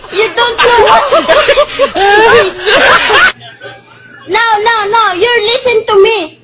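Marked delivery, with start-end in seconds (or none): 1.1-3.3 loud, long laughter that keeps going; helpless laughing fit. Real, not acted.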